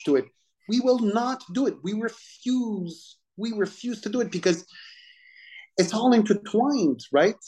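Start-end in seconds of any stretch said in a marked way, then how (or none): 0.7-4.3 resolute, slowed-down vow; firm refusal. Real, not acted. Firm stand on quality.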